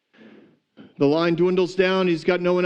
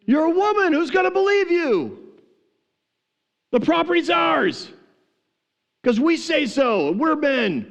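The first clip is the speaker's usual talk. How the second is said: hammed-up mocking taunt in a much higher voice; mocking men; 12 semitones higher than usual. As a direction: sneer at her like a bully. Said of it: "And they were like".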